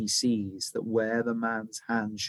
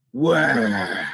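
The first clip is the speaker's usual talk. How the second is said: grinding stone voice; 7 dB louder than usual. A narrator's reading. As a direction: scrape the words out like rock. Menacing sailor demand.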